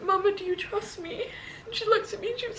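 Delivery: quoting herself through tears; a catch of crying in the voice; retelling her words to mum, voice wavering; tearful. Retold or imitated.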